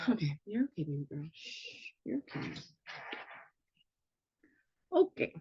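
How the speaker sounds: soft hushing to a cat; calm the scared cat, softly; soothing a pet. Real, not acted. Soothing her startled cat.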